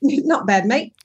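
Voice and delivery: playful copy of an Australian "mate"; mimicked Australian accent. Shown as a demonstration. Actress practises after her Australian interviewer, mimicked Australian accent. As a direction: try it Australian, echoing the interviewer. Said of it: "I just copy you. I'm a good mimic".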